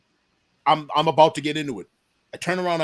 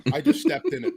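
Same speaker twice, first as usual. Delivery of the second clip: laughing embarrassment; 11 semitones higher than usual. Retold or imitated.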